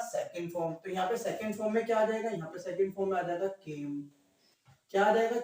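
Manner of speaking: normal talking voice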